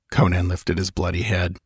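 ordinary delivery

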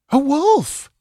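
high, startled cry; exclaiming. A narrator's reading. Frightened woman crying out at the yell, high. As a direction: blurt it in alarm. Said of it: "exclaimed one of the women".

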